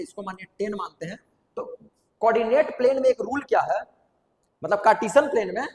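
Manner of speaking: ordinary talk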